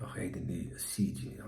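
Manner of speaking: very soft, low mock Scottish; Scottish accent. Shown as a demonstration. Repeating his attempt, hushed Scottish accent. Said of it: "I'm trying to do a Scottish accent".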